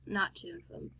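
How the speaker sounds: soft unvoiced whisper. Real, not acted. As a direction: whisper it as a sly aside. Playful correction after miscount.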